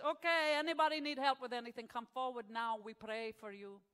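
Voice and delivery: loud proclamation easing down in mock Italian; mock Italian-accented Pope. Pope addressing the Glasgow congregation, mock Italian accent. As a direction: call out like a cartoon Italian pontiff. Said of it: "and the Pope says".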